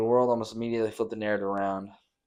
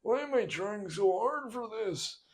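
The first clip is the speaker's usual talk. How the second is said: put-on high quavering old man, slow and quiet; whimpering old man giving up; high, slow, shaky elderly whine; mock senile Biden; 11 semitones higher, 6 dB quieter than usual. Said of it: "it's like".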